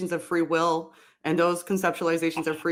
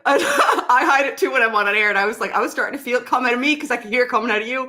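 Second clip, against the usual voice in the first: quick, giggly confession, pitched up; laughing confession; 5 semitones higher, 4 dB louder, faster than usual. Real, not acted. Host admitting to caller his accent slipped out, laughing. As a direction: talk fast through the laughter.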